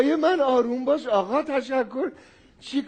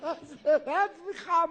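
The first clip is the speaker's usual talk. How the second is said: laughing apology; 5 semitones higher than usual. Real, not acted. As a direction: try to apologise but can't stop laughing. (عذرخواهی آرام با خنده واقعی)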